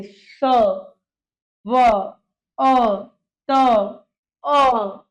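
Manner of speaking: slow sound-by-sound demonstration; sounding out slowly. Shown as a demonstration. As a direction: separate sounds with long gaps. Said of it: "मुख से अपना आप ही आपको स्वर निकलेंगे".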